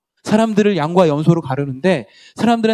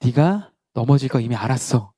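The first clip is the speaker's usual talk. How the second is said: gentle Jesus voice; 3 semitones lower than usual. Shown as a demonstration. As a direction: tell Peter gently he already knew. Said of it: "제가 만약 대사를 낸다면". (베드로에게 다정하게 말하는 예수님)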